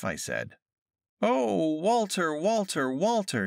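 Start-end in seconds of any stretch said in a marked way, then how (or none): 1.2-3.5 high, plaintive wail falling on each name; keen the name over and over, pitched high. A narrator's reading. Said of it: "she keened".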